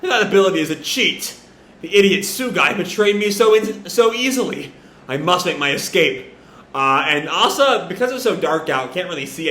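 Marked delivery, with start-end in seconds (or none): none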